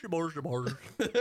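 nonsense Swedish gibberish sounds; mock Swedish. Swede on the phone line, mock Swedish accent. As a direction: imitate Swedish with mumbo-jumbo syllables. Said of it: "In their Swedish accent".

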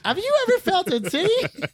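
high-pitched mock disbelief; incredulous. Asking the forty-year-old virgin in the movie, mock incredulous. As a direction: ask it in mock shock, pitch leaping up.

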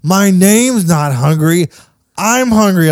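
mock-indignant child correcting dad; protesting daughter. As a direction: insist like an exasperated child, drawing it out. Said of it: "And she's like".

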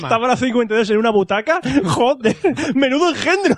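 laughing while talking. Real, not acted. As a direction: laugh through the words. Laughing at the Miss candidates.

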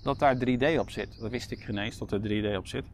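normal talking voice

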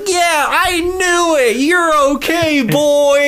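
high, slow, delighted cry; overjoyed. Retold or imitated. Jamie greeting his returning dog, high and overjoyed. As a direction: burst with joy at the dog's return.

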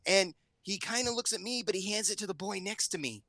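a hint of a child telling her story; daughter retelling. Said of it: "And she goes".

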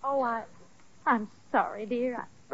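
soft, broken-up sorry; a touch of weepy contrition; tearful apology. Acted. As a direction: apologize while near tears, quietly. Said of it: "Don't cry".

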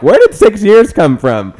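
high, loud mock disbelief; incredulous quote. Host retelling a commenter's reaction to six years, loudly incredulous. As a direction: blurt it out, imitating a baffled commenter.

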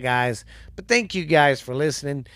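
plain reading voice

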